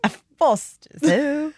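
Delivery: put-on joking Australian accent, rising high at the end; mock Australian accent. Echoing her cohost's beer request in a mock Australian accent. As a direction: echo the beer line in a cartoon Australian accent. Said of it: "I can't really do an Australian accent".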